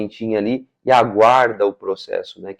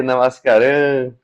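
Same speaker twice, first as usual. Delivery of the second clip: ordinary delivery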